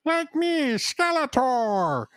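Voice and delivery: high, drawn-out cartoon battle cry; Skeletor show parody. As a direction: stretch the villain's name out theatrically. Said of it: "Mark Hamill's voice as Skeletor".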